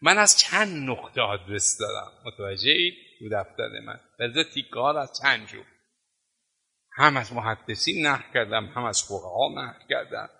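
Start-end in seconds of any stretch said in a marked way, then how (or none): none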